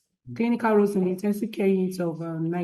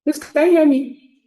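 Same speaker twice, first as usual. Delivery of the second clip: calling out; 6 semitones higher, 9 dB louder than usual. Real, not acted. Checking audio on a call.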